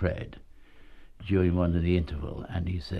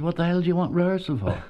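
high-pitched, quick mock exasperation; incredulous retort; 9 semitones higher, faster than usual. Fred to young actor asking for rehearsal, incredulous retort. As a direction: act out a scornful, disbelieving comeback.

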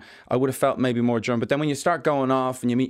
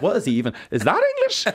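mock bafflement; 7 semitones higher than usual. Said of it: "and you're like".

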